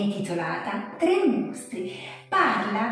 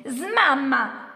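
mild storybook shout; 3 semitones higher, 4 dB louder than usual. A narrator's reading. Second monster orders stranger away, mild shout. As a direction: call out a sharp dismissal, not full volume. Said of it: "urlò il secondo mostro".

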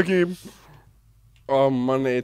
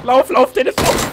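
higher, louder calling out to run; urgent shout; 7 semitones higher, 6 dB louder, slower than usual. Real, not acted. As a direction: yell him to safety. Urging teammate to run.

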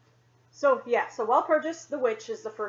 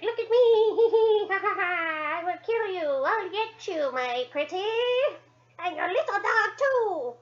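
cackling witch voice, much higher, loud; wicked witch; 10 semitones higher, 5 dB louder than usual. Retold or imitated. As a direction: ham up the Wicked Witch, cackle and threaten, slow. Mimics Wizard of Oz witch.

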